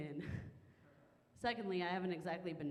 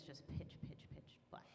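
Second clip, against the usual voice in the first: hushed whisper for the pitch dark; 9 dB quieter than usual. Acted. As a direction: drop to a breathy whisper.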